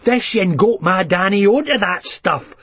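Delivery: pointing the finger in a fury; mild venomous Leith mother; venomous accusation. Acted. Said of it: "pointed venomously at me".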